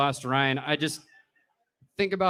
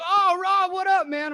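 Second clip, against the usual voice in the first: much higher, loud dopey greeting; goofy Ryan voice; 5 dB louder than usual. Retold or imitated. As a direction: play him as a dazed drunk happy to see you. Ryan's zombie greeting retold.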